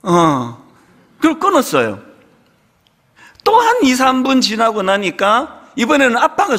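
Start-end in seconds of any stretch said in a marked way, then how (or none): 0.0-0.7 a faint, lifeless little answer, lower; barely alive, mumbled yes; weak dying voice. Retold or imitated. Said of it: "얘가 다 죽어가는 목소리로요".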